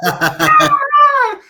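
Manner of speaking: loud high yelp; high shocked shriek. Retold or imitated. Retelling the scream at the shot. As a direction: shriek again in disbelief.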